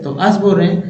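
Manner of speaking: usual speaking voice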